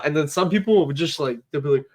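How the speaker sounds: ordinary talk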